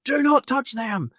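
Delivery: high, stretched yell of alarm; re-enacted panicked command, loud and high; urgent shouted warning. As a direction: shout it to stop a hand mid-reach. Retold urgent warning from companion.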